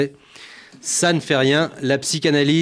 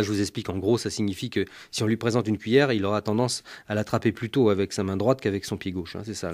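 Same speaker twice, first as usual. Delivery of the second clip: usual speaking voice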